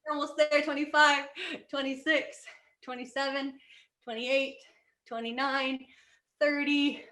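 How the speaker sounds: steady fitness coach count; workout counting. Acted. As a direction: count reps for the class. Counting squats with class.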